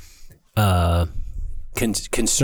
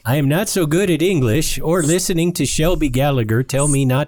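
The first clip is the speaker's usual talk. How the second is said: playful put-on Swedish accent, pitched a little higher; mock Swedish accent; 4 semitones higher than usual. Host reading a Swedish listener's comment in mock Swedish accent. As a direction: read it like a jokey Swede. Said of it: "I had to do my Swedish accent".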